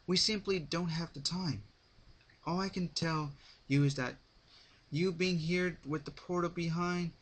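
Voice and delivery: a calm ruler explaining urgently; princess voice. A narrator's reading. Princess character voice held.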